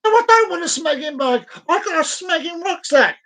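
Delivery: high-pitched exasperated outburst; acted fed-up customer snapping back; ranting, high and indignant; indignant retort. Acted indignant reply to cashier.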